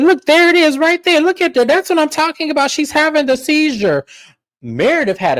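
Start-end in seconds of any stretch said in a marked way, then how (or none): 0.0-4.0 very high taunting voice; mocking Brittany. Host imitating Brittany taunting Meredith, very high-pitched. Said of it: "Brittany said".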